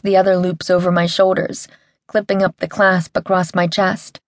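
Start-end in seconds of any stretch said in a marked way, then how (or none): none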